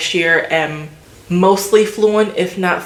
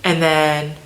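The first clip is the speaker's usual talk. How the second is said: ordinary talk, nothing marked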